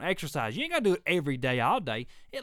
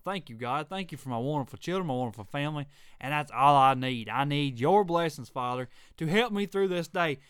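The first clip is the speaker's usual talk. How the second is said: prayer; 4 semitones lower than usual. Real, not acted. Prays aloud mid-rant.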